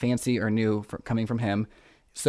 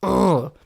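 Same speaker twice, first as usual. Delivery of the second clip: frustrated groan; 7 semitones higher, 6 dB louder than usual. Real, not acted. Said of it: "I just made a really weird voice".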